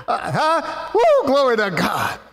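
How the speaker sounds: high laugh breaking into shout of glory; exultant praise cry; joyful exclamation. Real, not acted. Preacher exults after revelation.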